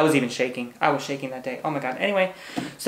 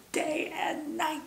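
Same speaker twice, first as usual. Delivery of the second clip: airy echo; 12 semitones higher, 11 dB quieter, slower than usual. Real, not acted. Dreamy echo of own words.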